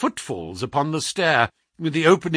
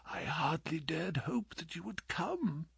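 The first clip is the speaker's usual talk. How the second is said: very soft, frail and higher; weak whisper; 3 semitones higher, 15 dB quieter than usual. A narrator's reading. Sick man thanks visitor for coming, whispering feebly. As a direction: breathe it out with almost no strength. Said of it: "Holmes whispered".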